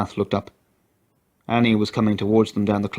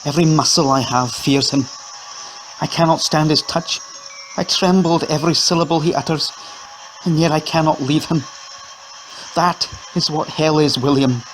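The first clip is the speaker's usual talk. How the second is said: higher, rising despair; anguished confession; 7 semitones higher than usual. A narrator's reading. Annie telling William what her husband does, anguished. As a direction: let the fear break through.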